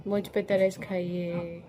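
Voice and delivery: slow, drawn-out, low begging; softly milk it for pity, slow and low; mock pleading. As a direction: put on a pitiful beggar's plea, stretching each word. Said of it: "Busra भी बोल रही है".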